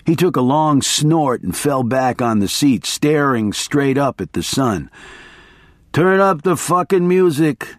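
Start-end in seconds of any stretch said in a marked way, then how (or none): 5.9-7.7 a mild, pitched-up mock scream; screamed order. A narrator's reading. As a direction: holler it over the wind, only half full. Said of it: "he screamed".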